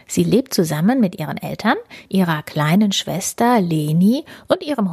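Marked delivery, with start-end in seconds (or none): none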